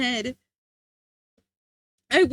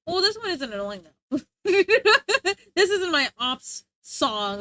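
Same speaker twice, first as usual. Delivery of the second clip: ordinary talk, nothing marked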